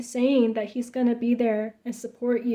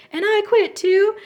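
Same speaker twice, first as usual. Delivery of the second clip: much higher, squeaky mock declaration; mock fake solidarity; 11 semitones higher, 7 dB louder than usual. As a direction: squeak it out like a coward faking loyalty. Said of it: "his turn to be like".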